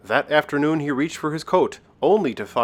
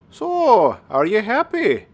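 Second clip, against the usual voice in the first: high, tiny sprite voice; small, high-pitched voice out of nowhere; small leprechaun voice; 11 semitones higher, 6 dB louder, slower than usual. Acted. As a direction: pipe up high, like a wee leprechaun. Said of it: "came a small voice".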